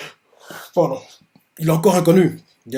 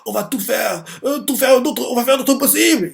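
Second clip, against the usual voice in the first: in tears; 8 semitones higher than usual. Retold or imitated. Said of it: "il dit ça en larmes".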